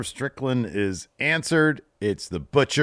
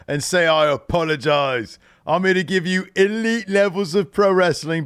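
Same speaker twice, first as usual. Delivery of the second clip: higher, louder British wrestler promo voice; Will Ospreay impression; 6 semitones higher, 4 dB louder than usual. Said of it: "I love your Ospreay impression".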